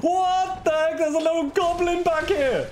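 high-pitched, incredulous exclamation; startled outcry. Real, not acted. Player finding a little goblin, startled high outcry. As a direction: yelp in surprise at the discovery.

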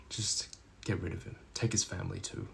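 low, very soft command; hushed menacing order. Acted. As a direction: quietly order a hit by phone. Quiet order on phone call.

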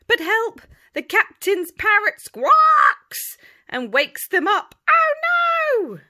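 high, loud alarm. A narrator's reading. Storyteller crying out as the parrot wakes them. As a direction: squawk it out in alarm.

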